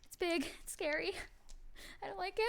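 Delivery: high, frightened little voice; scared small voice. Acted. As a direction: whimper it like a scared child. Scared character whimpering to friend.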